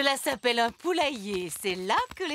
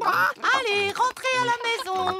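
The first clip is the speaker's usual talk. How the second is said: high, louder call to the hens; 11 semitones higher, 4 dB louder than usual. Acted. Granny Pig calling her hens into the coop. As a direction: shoo them home in a sing-song call. Granny shooing chickens inside.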